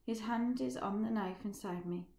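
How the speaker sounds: plain reading voice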